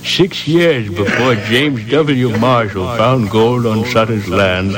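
slow, measured newsman read with echo. Shown as a demonstration. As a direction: take your time, announce it. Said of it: "emphasize the word march".

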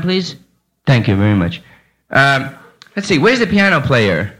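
ordinary delivery

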